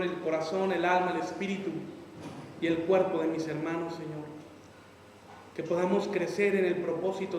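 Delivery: soft, low prayer voice; closing prayer. Acted. Pastor praying over his congregation, soft and low. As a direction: pray gently over the congregation.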